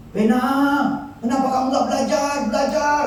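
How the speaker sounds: high, fast nagging parent; scolding parent. Retold or imitated. Father nagging son Bena to study, high scolding. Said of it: "kita sering ngomong".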